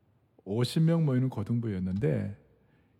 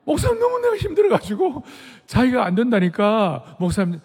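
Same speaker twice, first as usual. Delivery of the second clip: distressed plea; 9 semitones higher, 7 dB louder than usual. Retold or imitated. Retelling the assistant's distressed plea.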